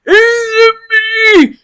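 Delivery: put-on high, slow, quavering old yell; old priest yelling. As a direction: call out like a frail old priest. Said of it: "Father Yosep kind of yells back".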